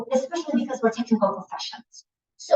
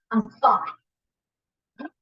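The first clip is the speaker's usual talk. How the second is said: clipped-off, offhand I'm fine; curt fine. Shown as a demonstration. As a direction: brush the question off. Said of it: "That's tone".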